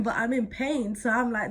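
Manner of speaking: ordinary delivery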